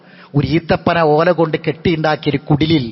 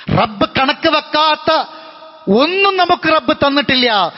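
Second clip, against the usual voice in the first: impassioned preaching; 7 semitones higher than usual. Acted. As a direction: lift the voice to drive the point home. Preaching climax.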